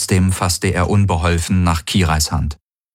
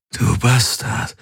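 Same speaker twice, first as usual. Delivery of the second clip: croaked slowly, higher pitched; triumphant croak; 5 semitones higher, slower than usual. A narrator's reading. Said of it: "krächzte er triumphierend". (triumphierend gekrächzt)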